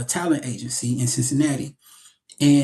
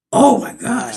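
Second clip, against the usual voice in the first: high-pitched reenacted thrill; retold excitement; 9 semitones higher, 4 dB louder, slower than usual. Actor recalling his reaction to "I love it", high excited reenactment.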